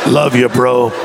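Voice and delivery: low, terse mock-macho mumble; understated bro voice, dropped low; guy's awkward affection. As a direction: imitate a guy reluctantly showing love, pitched low. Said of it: "So we'll punch each other".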